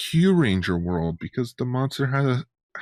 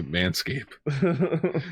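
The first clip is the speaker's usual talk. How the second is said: drawn out; 5 semitones higher than usual. Acted. Drawn-out sponsor name.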